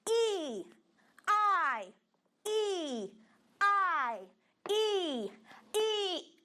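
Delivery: teacher drilling English letters aloud. Shown as a demonstration. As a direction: call out each letter for the class. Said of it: "a gente só vai repetindo essas duas letras".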